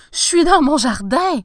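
high, loud exclamations of discovery; very excited. A narrator's reading. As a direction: exclaim it as the amazement mounts. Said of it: "très excité".

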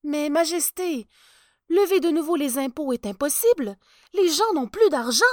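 anxious chancellor protesting; fretting chancellor. Acted. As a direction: plead with the king, worried. Chancellor objects.

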